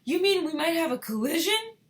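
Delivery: high, loud, disbelieving question; alarmed cry. A narrator's reading. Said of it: "cried Mister Teavee".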